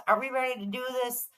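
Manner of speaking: ordinary talk, nothing marked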